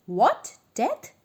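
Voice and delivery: shocked, rising high on Death; enraged howl. A narrator's reading. Merchant howling at the judge's verdict. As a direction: howl in outraged disbelief. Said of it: "howled an enraged".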